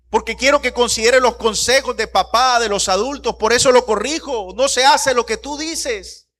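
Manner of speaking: high, loud, driving preacher's delivery; impassioned preaching. Real, not acted. Preacher telling church why he corrects his son, impassioned. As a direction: pile up the reasons with rising fervor.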